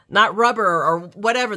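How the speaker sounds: normal talking voice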